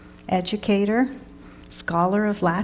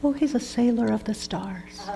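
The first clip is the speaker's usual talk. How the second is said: lightly voiced small-talk exchange; imagined party chat; 3 semitones higher, 5 dB quieter, faster than usual. Teacher acts out an imagined conversation starter, light small-talk voice. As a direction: toss it off like cocktail-party gossip. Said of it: "Can you imagine a conversation starter?".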